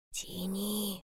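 very soft, urgent bear-cub whisper. A narrator's reading. Bear cub telling hedgehog to pull, whisper. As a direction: breathe the command very quietly. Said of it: "шепнул медвежонок".

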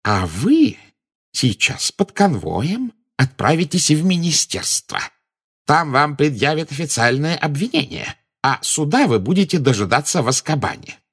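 high, gloating pronouncement; triumphant. A narrator's reading. Minister tells Dumbledore he is arrested, triumphant. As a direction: announce the sentence high and exultant. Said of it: "всё ещё звенел от восторга".

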